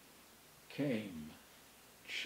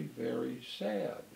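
ordinary delivery

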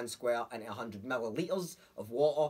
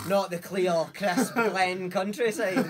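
mock grand praise; 6 semitones higher, 9 dB louder, much slower than usual. Mock-romantic Scottish water praise.

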